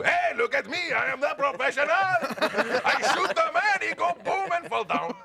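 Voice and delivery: very high, goofy accented shout; hammed-up high-pitched bragging, drawn out; loud, unsexy contrast. Shown as a demonstration. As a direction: yell it high like an excited buffoon. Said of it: "As opposed to".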